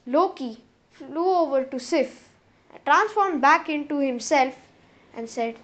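ordinary delivery

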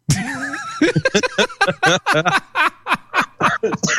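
long high-pitched laugh; high laughing fit. Real, not acted. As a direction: burst into squealing laughter. Laughing fit after joke.

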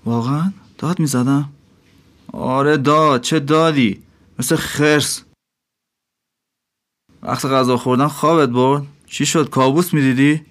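mocking innkeeper. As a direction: needle the dreamy dervish. Innkeeper voice held by narrator.

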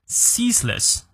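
"ceaseless" with the stress on its first syllable. Shown as a demonstration. Teacher models "ceaseless" for learners, first syllable stressed. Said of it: "注意重音在前".

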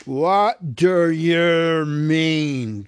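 very slow, loud troll demand. A narrator's reading. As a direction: drag it out like a troll. Bert holds him by hair.

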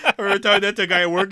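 laughing through the words, high and fast; giggling as the story spills out. Real, not acted. Laughing while telling a friend about a coworker.

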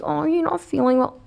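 high mock baby-talk coo; sugary, high-pitched pity; ex-boyfriend's baby voice. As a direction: imitate an ex babying you. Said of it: "my ex-boyfriend did that".

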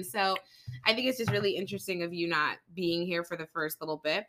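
ordinary talk, nothing marked

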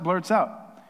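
usual speaking voice

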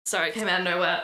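ordinary delivery

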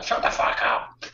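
mock rasped yell, drawn out without full voice; wife's outburst imitated. Guest imitating his wife telling him off in bed, hoarse mock yell. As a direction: stretch out a voiceless mock shout.

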